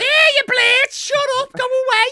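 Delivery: very high, loud Scouse yell; Mike Myers. Retold or imitated. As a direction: yell it as a squawking Scouse slasher. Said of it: "dubbed over a Scouse accent".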